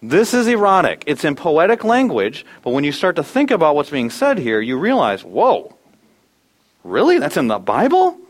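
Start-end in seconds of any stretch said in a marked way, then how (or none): 5.2-8.2 incredulous, pitched up on the question; mock astonishment. Reader discovering what the Bible says, acted disbelief. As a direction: act out a stunned double take. Acts out reader's shocked realization.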